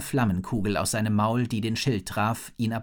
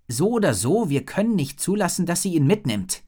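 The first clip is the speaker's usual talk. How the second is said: put-on determined girl; 6 semitones higher, 3 dB louder than usual. A narrator's reading. Rory, determined.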